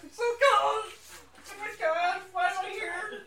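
high wailing freezing complaint; freezing whine. Retold or imitated. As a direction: whine about the cold, half crying. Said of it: "which is why it's bothering you".

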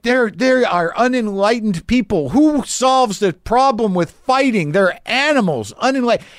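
lampoon his huffy outrage; mock indignant screaming. Neighbor ranting in the house about them, mock huffy whine. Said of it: "he started screaming after I left".

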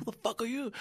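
high, puzzled aside; half-voiced mock bewilderment; baffled. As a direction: ask it in total confusion. Baffled at unknown greeter.

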